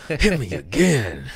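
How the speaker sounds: eager masochist. Retold or imitated. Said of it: "and I'm like".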